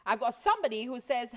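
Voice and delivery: normal talking voice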